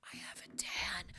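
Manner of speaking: breathy secret whisper. Shown as a demonstration. Confiding her tan to viewers, whispered. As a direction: whisper it like a confession. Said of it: "Okay, I have a tan".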